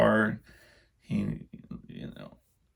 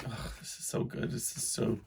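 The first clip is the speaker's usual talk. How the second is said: inner thought whispered; 10 dB quieter than usual. Retold or imitated. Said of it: "in my mind, I'm like".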